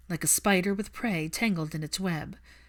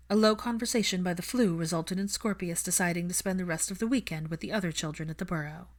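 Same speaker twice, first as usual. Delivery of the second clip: ordinary delivery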